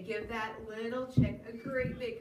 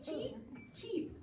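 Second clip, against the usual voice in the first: high chirping cheeps; teacher squeaks the chick's cheeps for toddlers; 8 semitones higher, 4 dB quieter than usual. Acted. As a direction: peep like a tiny chick. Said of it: "said the little chick yellow".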